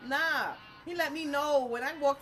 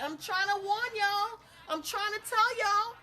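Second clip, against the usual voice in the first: high, insistent rising appeal; urgent warning; 10 semitones higher, 4 dB louder than usual. Real, not acted. Teller warning y'all about spirits, high and insistent. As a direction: push it up high, pleading with listeners. Warning listeners after the story.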